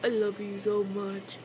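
bashful secret admission under the breath; shy soft confession. Acted. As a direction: murmur it so they won't hear.

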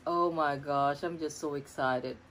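usual speaking voice

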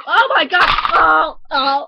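loud, high panicked cries; panicked shout. Acted. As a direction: yell in alarm. Crash panic.

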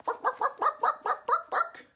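high yapping bark sounds; yappy barking. Pretend. Storyteller teasing the kids with high yapping barks. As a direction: yip like a little dog. Guessing game animal sound.

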